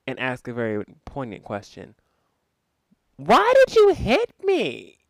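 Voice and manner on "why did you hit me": put-on very high, slow, whiny child's plea; boy asking his grandma why she hit him, very high and slow; confused little boy. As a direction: plead like a hurt, baffled little boy. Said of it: "cry and then say".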